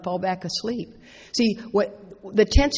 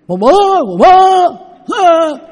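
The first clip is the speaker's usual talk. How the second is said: much higher, much louder cartoon wail of mama; 10 semitones higher, 14 dB louder, slower than usual. Speaker acts out the Bavoom cartoon for the audience, shrill and loud. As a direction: belt it out like a cartoon character calling mama. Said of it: "Do y'all remember that cartoon?".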